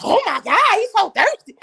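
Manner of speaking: high, loud squeal of mock disgust. Girlfriend griping about a dating-app texter, high mock disgust.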